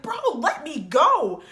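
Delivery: loud, high, drawn-out protest; slow, emphatic panicked demand; urgent demand. Retold or imitated. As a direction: protest loudly as if pulling free of a grip. Retold demand while arm is held.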